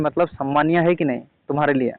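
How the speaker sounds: normal talking voice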